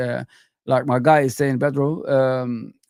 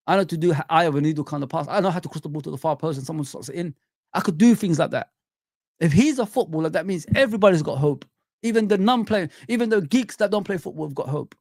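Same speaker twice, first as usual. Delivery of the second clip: normal talking voice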